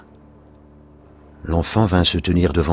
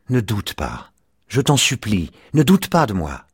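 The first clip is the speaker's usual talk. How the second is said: higher, mounting plea; beg, climbing with each phrase; pleading; 5 semitones higher than usual. A narrator's reading. Child begs his father not to doubt him, pleading.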